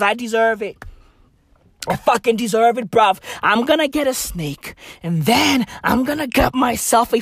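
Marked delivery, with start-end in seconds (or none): none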